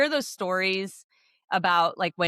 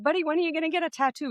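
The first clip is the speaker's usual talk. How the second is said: teasing; 10 semitones higher, faster than usual. Retold or imitated. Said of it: "We're like".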